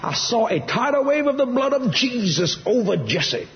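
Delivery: high, urgent proclamation; impassioned testimony pitched well up; retold fervent mother. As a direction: imitate mama insisting on her vision. Acting out mom insisting to dad.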